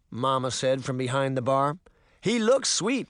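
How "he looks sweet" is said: joking bartender. Acted. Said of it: "giggled at her joke like a little girl".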